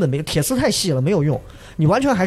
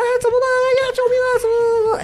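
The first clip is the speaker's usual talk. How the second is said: much higher, imitating frantic cries for help; mock panicked yelling; faster than usual. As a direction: play the helpless shouting from the rock. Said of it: "我们就在那儿喊".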